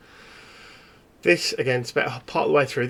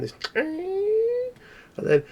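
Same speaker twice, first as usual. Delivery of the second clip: high drawn-out wail; much slower than usual. Acted.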